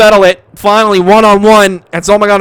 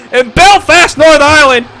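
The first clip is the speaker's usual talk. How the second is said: higher, punchy location call; announcer hype; 6 semitones higher, 4 dB louder than usual. Acted. Commentator hyping the Belfast crowd, punchy. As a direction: sell the city to the crowd.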